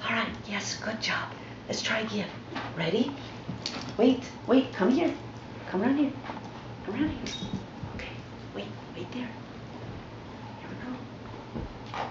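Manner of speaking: quiet, murmured cues to the dog; hushed voice. Real, not acted. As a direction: keep it low so the dog stays calm.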